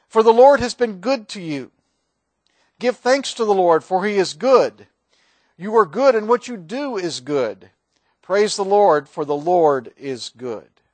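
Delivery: solemn scripture proclamation; psalm reading. Acted. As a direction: declare the verses to the congregation.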